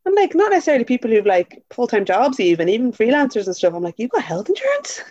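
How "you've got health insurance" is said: high-pitched incredulous question; retold disbelief. Friend to people with health cover, incredulous. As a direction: lift it into a mock-astonished question. Retelling her shocked reaction.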